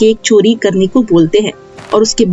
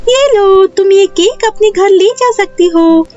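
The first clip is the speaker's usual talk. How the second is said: high-pitched magical fairy voice; kindly fairy queen; 9 semitones higher than usual. Acted. Fairy hands Neha the magic cake with rules, kindly high voice. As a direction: give instructions as a fairy, pitched well up.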